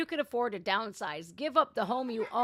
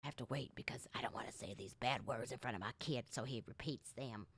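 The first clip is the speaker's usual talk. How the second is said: whisper; 9 semitones lower, 10 dB quieter than usual. Real, not acted. A dad hiding swear words from his son, whispering. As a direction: whisper it quickly, keep it from little ears. Hides bad words from child.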